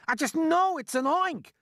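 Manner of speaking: high, loud panicked insistence; screaming. A narrator's reading. Fire chief insisting on his guess, screaming. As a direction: scream it with total conviction. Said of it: "screamed the head of the fire department".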